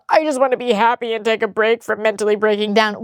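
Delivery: sarcastic parroting of his line; mocking his words. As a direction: throw his own words back at him, mocking. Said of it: "pull the".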